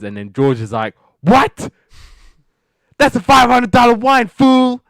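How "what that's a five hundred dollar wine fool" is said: much higher and louder indignant shout; furious boss yelling about his expensive wine; outraged yell. Retold or imitated. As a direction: blow up at the maid over the wine. George outraged over his wine.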